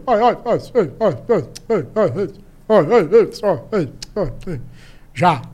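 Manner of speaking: lightly imitated old man stammering; fake stammer. Grandson imitating grandpa's fake stammer, mild. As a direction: stutter the syllables on purpose. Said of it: "uma técnica que é gaguejar".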